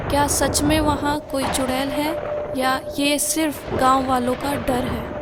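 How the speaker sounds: ordinary delivery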